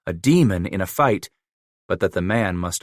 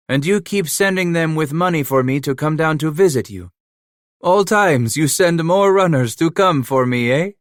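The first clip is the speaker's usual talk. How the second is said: put-on teasing, a bit higher and louder, French-accented; French-accented Emile, mocking; 8 semitones higher, 5 dB louder than usual. A narrator's reading. Trapper Emile mocking Leclerc's runners, lightly French-accented. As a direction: needle him, half laughing in a French accent.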